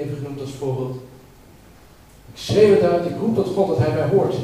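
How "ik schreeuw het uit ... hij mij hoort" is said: loud, raised plea to God; crying out to God. Acted. Preacher reading the psalm aloud to the congregation, loud cry. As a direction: call it out as a desperate cry. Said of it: "Je hoeft niet te schreeuwen".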